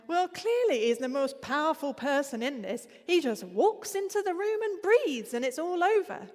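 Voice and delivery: a hint of Northern English accent, loud and high. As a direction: lightly mimic a blunt Northern English woman. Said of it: "I'm really sorry about the accent".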